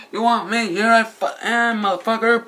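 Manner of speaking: high, loud challenge; action hero taunt. Retold or imitated. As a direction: call the monster out. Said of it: "goes in there".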